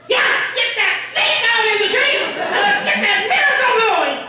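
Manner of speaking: high, loud shouted order; comic burst of mom yelling; exasperated yelling. Retold or imitated. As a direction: lose all patience and holler. Patient mother snaps into yelling.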